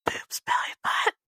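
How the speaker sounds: voiceless retold stage whisper. Interviewee whispering the three Bs at the interviewer, whispered. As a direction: whisper a naughty secret across to someone. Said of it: "I just kinda whispered, like, at him".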